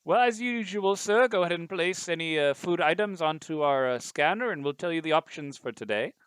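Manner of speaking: lightly voiced butler; a hint of a polite butler. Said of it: "and it says".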